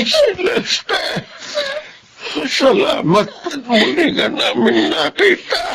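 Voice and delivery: high, crying and laughing at once; choked happy tears, voice high; tearful joy. Acted. Brother greets his found sister, crying with joy.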